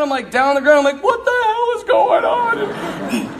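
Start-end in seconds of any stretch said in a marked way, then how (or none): 1.0-3.4 much higher, slow, laughing; overwhelmed cry of confusion; panicked alarm. Retold or imitated. Girl thrashing after communion.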